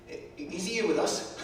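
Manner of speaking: ordinary delivery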